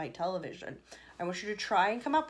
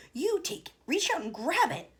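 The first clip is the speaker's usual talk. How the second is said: eager urging; 7 semitones higher, 5 dB louder than usual. A narrator's reading. Wonka urging Charlie on.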